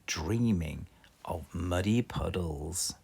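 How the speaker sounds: usual speaking voice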